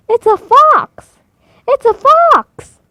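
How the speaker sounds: very high, loud, slow squawking cry; terrified shriek. A narrator's reading. Hattie screams to the farm animals that a fox is there, very high and loud. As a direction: shriek the alarm like a panicked hen.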